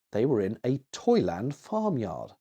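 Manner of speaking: plain reading voice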